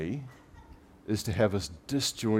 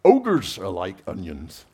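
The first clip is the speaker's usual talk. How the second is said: put-on Scottish accent; 8 dB louder, slower than usual. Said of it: "Can't do the Scottish accent very well".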